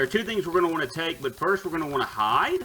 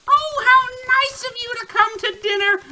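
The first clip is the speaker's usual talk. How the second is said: very high, sugary witch, a bit louder; sweet witch voice; 5 dB louder than usual. Acted. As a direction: lure the guest in like a witch. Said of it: "the witch remarks".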